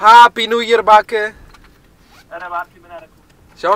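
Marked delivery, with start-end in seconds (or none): 0.1-1.3 shouted, high and excited; loud greeting. Real, not acted. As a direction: call it out loud and happy.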